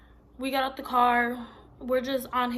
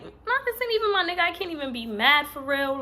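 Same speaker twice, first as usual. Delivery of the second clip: retold dismissive reaction; 5 semitones higher, 4 dB louder than usual. Retells own reaction, high pitched.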